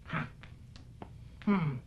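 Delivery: normal talking voice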